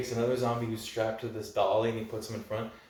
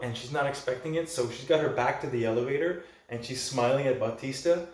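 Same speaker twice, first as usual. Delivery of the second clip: ordinary talk, nothing marked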